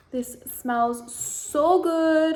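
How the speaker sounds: put-on a slow, loud, drawn-out unknown foreign accent; drawled odd accent, said with relish; unplaceable put-on accent. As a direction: ham up a mystery accent, stretching every word. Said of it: "I don't know what accent that is".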